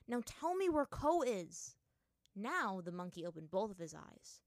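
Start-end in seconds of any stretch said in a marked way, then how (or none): 0.1-1.8 slow, loud, higher-pitched demand; rough demand. A narrator's reading. Aang insists the monkey answer him, roughly. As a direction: insist forcefully, each word drawn out. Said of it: "Aang said roughly".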